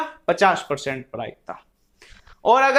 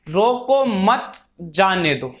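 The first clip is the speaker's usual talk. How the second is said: रोको मत together, brief pause, then जाने दो; pause after मत; 3 semitones lower, slower than usual. Shown as a demonstration. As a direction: break the phrase right after मत, then let जाने दो fall. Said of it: "के बाद". (रोको मत के बाद विराम, फिर जाने दो)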